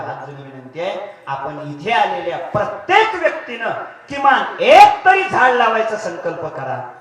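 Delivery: loud, urgent sermon appeal; preaching plea. Acted. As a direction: beg the crowd with full force. Preacher pleads to plant trees. (कळकळीने मोठ्याने केलेले प्रवचन)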